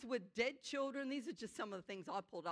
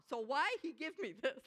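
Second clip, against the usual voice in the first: laughing; 5 semitones higher than usual. Retold or imitated. Patient laughing retold.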